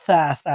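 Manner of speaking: সা with tongue tip at the upper teeth, as "tha". Shown as a demonstration. Teacher showing the tongue position, lisped সা. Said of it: "জিহ্বার আগার যদি আপনি হালকা একটু বের করে".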